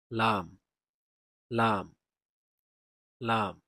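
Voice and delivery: loud, clear লাম from the tongue tip; lam drill. Shown as a demonstration. Teacher repeating লাম for the class, loud. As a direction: ring out লাম, tongue on the gums. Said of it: "লাম উচ্চারিত হবে".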